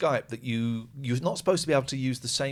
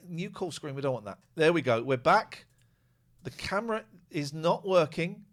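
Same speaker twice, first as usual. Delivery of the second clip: ordinary talk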